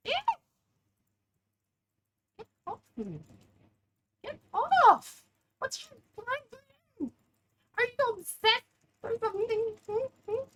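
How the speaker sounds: very high, loud mock outrage; high teasing shout. Real, not acted. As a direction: tease the chaser. Teasing enemy player chasing her.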